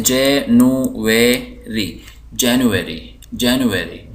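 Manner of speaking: January slowly as JE, nu, we, ri; syllable by syllable. Shown as a demonstration. Teacher introducing English month names, January slowly split. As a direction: separate each syllable of January. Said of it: "देखते हैं महीनों को क्या कहते हैं".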